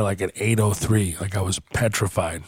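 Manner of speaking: ordinary talk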